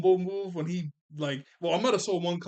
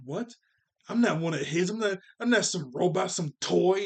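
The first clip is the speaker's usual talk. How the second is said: louder, indignant outburst; mad; 4 semitones higher, 5 dB louder than usual. Retold or imitated. Ultron furious at being called Tony's creation, louder indignant outburst. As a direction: snap back, offended, louder. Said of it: "Ultron got super mad".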